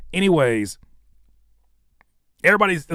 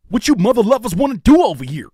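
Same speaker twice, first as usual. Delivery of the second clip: loud, higher, brash dubbed-movie voice; movie voiceover impression; 7 semitones higher, 8 dB louder, faster than usual. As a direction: belt it like a TV-edit dub. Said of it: "that kinda energy".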